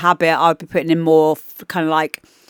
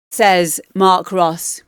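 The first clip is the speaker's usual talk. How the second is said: ordinary delivery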